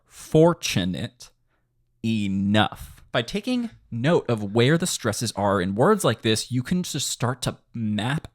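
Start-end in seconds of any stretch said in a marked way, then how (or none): 0.1-3.0 "Fortunate enough" stretched syllable by syllable; slow it down so each stress shows; a bit slower. Shown as a demonstration. Teacher repeats the phrase for students, slower and syllable by syllable. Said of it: "say that a little bit slower".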